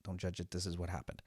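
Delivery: hushed, telling yourself to stay calm; low, soft reminder to yourself; quiet self-talk. Retold or imitated. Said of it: "I'm telling myself".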